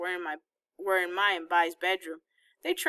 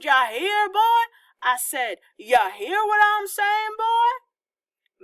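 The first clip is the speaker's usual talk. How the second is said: put-on Southern hillbilly accent building higher and louder to a yelled boy; hammed-up hillbilly drawl, rising to a high loud taunt; 6 semitones higher, 6 dB louder, slower than usual. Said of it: "did an imitation of a hillbilly accent".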